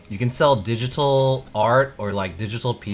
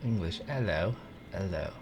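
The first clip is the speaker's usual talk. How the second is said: softly trying out an English accent; English accent practice; 10 dB quieter than usual. Shown as a demonstration. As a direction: test an English accent under your breath. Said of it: "I have to get into English".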